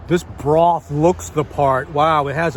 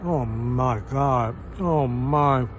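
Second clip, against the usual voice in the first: awed murmur; 5 semitones lower, 5 dB quieter, slower than usual. Real, not acted. Tasting tendon, awed.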